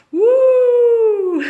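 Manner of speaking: high, loud whoop at the strong smell; hammed-up woo; impressed whoop.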